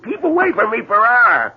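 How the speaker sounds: high, sharp warning shout; alarmed warning. Acted. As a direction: keep him back at gunpoint. Threatening with a gun.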